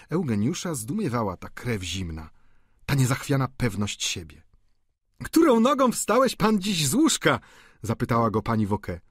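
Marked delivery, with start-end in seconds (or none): 5.2-7.4 much higher, louder landlady voice; landlady teasing a boarder, higher and louder. Acted. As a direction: poke fun at him as the landlady. Landlady teases Vautrin.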